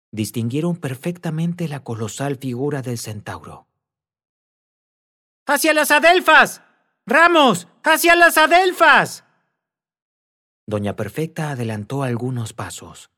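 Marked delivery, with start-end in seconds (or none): 5.4-9.2 high, loud shout across the dark; frantic high shout; calling out. A narrator's reading. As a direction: yell to him from far away.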